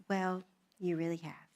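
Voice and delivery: low, hushed, serious reply; grave near-whisper. Retold or imitated. Husband telling new wife she erred, gravely hushed. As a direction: imitate a solemn husband, almost whispering. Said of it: "came to me and was very serious".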